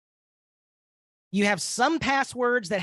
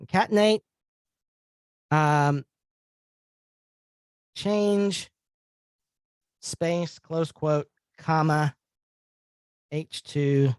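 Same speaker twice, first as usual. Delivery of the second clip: dictating; 5 semitones lower, slower than usual. Shown as a demonstration. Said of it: "just like that".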